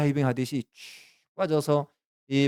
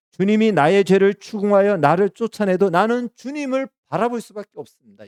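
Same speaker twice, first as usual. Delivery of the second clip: loud, higher pleading prayer; fervent prayer; 8 semitones higher, 8 dB louder than usual. Acted. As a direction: cry out the prayer with full conviction. Preacher voicing Jonah's prayer. (큰 소리로 간절하게 부르짖는 기도)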